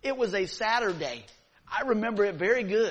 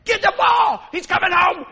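higher, much louder yelling dad; 11 semitones higher, 14 dB louder than usual. Retold or imitated. Pastor retells his dad yelling from the stands, loud high shout. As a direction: bellow it from the stands like a frantic father. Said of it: "I heard my dad".

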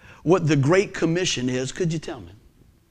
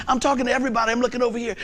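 ordinary delivery

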